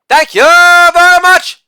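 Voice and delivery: loud "Holla", then a very high, loud "thank you very much"; hammed-up falsetto victory call; catchphrase shout. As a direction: belt out the catchphrase like a hype man.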